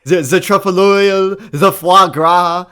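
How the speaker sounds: put-on fading French accent, foie gras drawn out slowly. Co-host names fancy dishes in a failing French accent. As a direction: give the French accent one last effort before it collapses. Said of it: "I can't do it, French accent".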